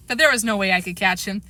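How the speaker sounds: ordinary delivery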